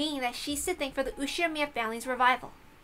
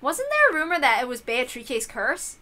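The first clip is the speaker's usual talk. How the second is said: ordinary talk, nothing marked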